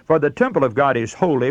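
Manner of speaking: ordinary talk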